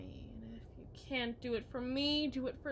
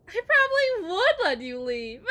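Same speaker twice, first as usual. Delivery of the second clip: much louder, higher, dissolving into laughter; laughing cackle; 8 semitones higher, 15 dB louder than usual. Acted. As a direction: cackle it out in cruel delight. Wife cackles at husband.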